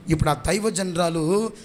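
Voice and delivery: ordinary talk, nothing marked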